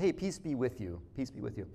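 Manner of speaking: soft, low, offhand hello; casual greeting. Acted. Jesus walking in on frightened disciples, casual greeting. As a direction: drop the greeting in softly and low. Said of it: "Very almost casual".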